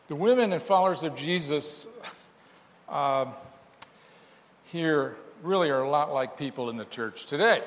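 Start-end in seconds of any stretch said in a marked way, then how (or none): none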